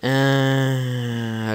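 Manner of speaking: ordinary talk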